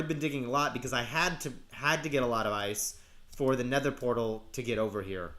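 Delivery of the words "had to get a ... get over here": usual speaking voice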